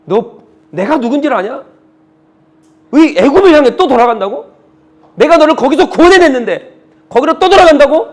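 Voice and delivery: building, indignant questioning as God; God's rebuke. Acted. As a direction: start level, rise to a loud, high rebuke. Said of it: "하나님께서 그 불평을 들으시고".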